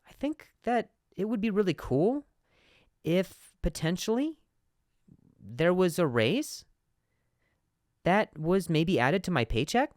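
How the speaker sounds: high questioning lifts on "cool", "potentially", "raise" and "paycheck"; slow, halting uptalk, rising again on "raise"; condescending uptalk. Acted. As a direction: turn each phrase up to "paycheck" into a question. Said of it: "almost a condescending tone to ask questions".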